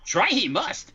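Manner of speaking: Yoda. Acted. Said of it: "that was not Yoda".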